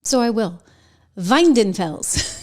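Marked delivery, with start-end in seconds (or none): 1.2-2.4 Weidenfels with a German V, slow and deliberate; German pronunciation. Shown as a demonstration. Host pronouncing the new CEO's name, German pronunciation. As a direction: say Weidenfels the German way, VY-den-fels. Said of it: "I always wanna pronounce it in German".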